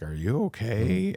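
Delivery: imitated concern. Wife to her trembling, weeping husband, imitated concern. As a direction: imitate a worried check-in, drawn out.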